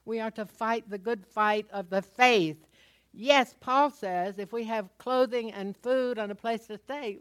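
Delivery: loud, driving preacher voice; full-force preaching. Real, not acted. Pastor exhorting the congregation on faith, loud preaching.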